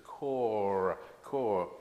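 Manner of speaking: "core" said slowly with the R sounded. Shown as a demonstration. Teacher slowly showing "core" for learners. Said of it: "we have to say that R".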